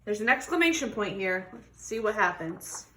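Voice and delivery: ordinary delivery, nothing marked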